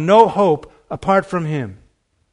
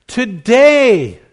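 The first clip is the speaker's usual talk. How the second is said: loud, higher impassioned preaching; preaching climax; 7 semitones higher, 5 dB louder than usual. Acted. Preacher pressing the promise on the congregation, full force. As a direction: build to the peak of the sermon.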